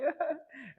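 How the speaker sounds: high mock giggle; imitated girlish laugh. Imitating his date laughing, effeminate giggle. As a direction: titter like an amused date. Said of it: "when she's laughing about something".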